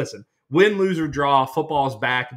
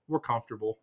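snobby; 4 semitones lower, 9 dB quieter than usual. Retold or imitated. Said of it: "look at 'em real snobby and just go".

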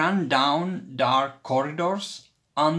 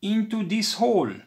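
hurried call; 7 semitones higher, 4 dB louder, faster than usual. A narrator's reading. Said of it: "Toby called".

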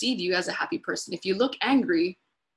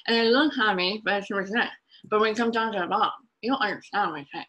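fast, hurried garble; overdramatic rush; faster than usual. Shown as a demonstration. Demonstrating garbled speech to workshop listeners, fast and hammed-up. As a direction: rush the words together into a blur. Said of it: "that was probably an overdramatic, uh, example".

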